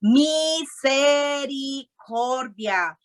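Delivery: Misericordia stretched very slow to a falling close; slow emphatic Misericordia; drawn-out answer. Shown as a demonstration. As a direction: drag the word out so it sinks in. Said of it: "qué?".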